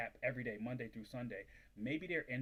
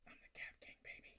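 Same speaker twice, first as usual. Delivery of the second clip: a breathy whispered boast; a sly whispered aside to viewers, proud of himself; whispered brag; 14 dB quieter than usual. Real, not acted. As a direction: let the brag slip out under your breath.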